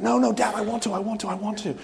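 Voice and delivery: put-on child begging dad to mow; pleading child. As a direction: beg like a little boy. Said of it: "And you're like".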